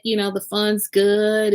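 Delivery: usual speaking voice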